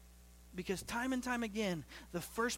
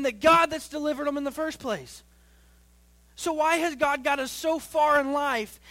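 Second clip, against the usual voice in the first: raised, forceful preacher's voice, higher and louder; impassioned preaching; 6 semitones higher, 9 dB louder than usual. Acted. Pastor challenging the church on trusting God, impassioned preaching. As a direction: drive each phrase home to the congregation.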